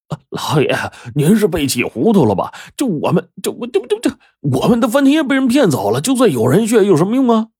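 high, stammering alarm; a panicked servant stammers, protesting to his master; flustered panic. Acted.

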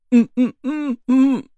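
high, closed-mouth anxious hums; muffled mumbling. Acted. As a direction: hum urgently without opening the mouth. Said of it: "嘴里支吾支吾的也不敢说话".

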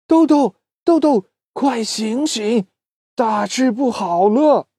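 high, urgent bug voice calling out; urgent wake-up call. Acted. Bug waking Doudou about a disaster, high and urgent. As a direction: shake a sleeping friend awake with alarm.

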